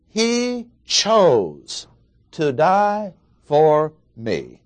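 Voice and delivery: slow, drawn-out CHOSE, starting high and falling; HE high and held, CHOSE stretched out for emphasis; stress on CHOSE. Shown as a demonstration. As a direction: spell it out slowly, dwelling on each word. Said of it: "emphasis on the word chose".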